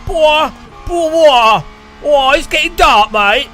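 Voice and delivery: high, loud blokey Brit calling it a day; mock British protester. Mocking British protesters packing up early, high loud laddish voice. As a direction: shout it up high like a lad heading home, mate. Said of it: "British protesters are like".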